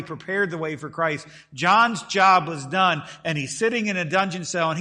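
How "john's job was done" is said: loud, slow, drawn-out declaration; slow and loud, stretched out for weight; emphatic. Real, not acted. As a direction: land each word like a hammer. Preacher driving the point home.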